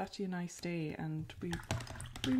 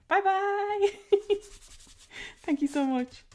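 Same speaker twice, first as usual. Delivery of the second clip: excited; 14 semitones higher, 7 dB louder than usual. Real, not acted. Waving off a leaving viewer.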